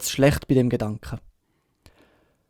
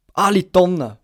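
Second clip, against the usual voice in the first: raised, louder exclamation from a sick captain; shouted outburst; 5 semitones higher, 6 dB louder than usual. A narrator's reading. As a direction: cry it out, higher and louder. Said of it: "hat der Captain geschrauen". (usegrüefe, luut und empört)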